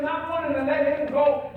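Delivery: ordinary talk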